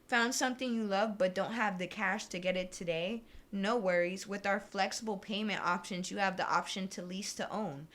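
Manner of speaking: usual speaking voice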